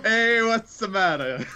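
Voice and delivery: high, slow, hammed-up Italian; jokey Italian-accent greeting; mock Italian accent. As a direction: stretch it out like a cartoon Italian. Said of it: "I can't pull an Amy at Italiano".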